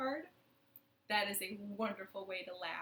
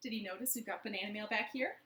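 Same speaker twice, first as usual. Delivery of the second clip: ordinary talk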